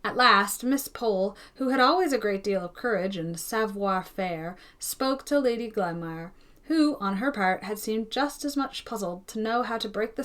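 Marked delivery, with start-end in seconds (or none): none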